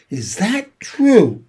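slow, high, suspicious question. A narrator's reading. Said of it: "eyeing the sac suspiciously".

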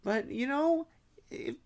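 oddly high-pitched chatter; weird high voice. Real, not acted. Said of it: "I don't know why I'm talking like this".

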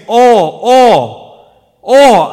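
each "all" shouted high and loud; loud, high preacher's stress on each "all"; emphatic repeated ALL. Real, not acted. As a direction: hammer every "all" as its own beat. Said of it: "I cannot emphasize that word enough".

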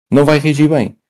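ordinary talk, nothing marked

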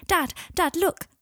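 high, loud, eager call; excited child. A narrator's reading. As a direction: burst out to show Dad. Boy bursts out with new wand.

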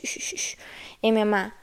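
usual speaking voice

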